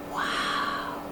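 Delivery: breathless whisper of awe. Acted. Jack seeing the long ladder, awed whisper. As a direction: whisper it in wonder. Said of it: "he whispered".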